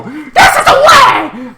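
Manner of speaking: slow, very high, loud shouted creed; hammed-up shout of the Mandalorian creed; catchphrase shout. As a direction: proclaim it like a battle cry.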